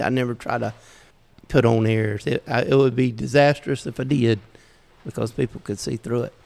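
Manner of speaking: ordinary talk, nothing marked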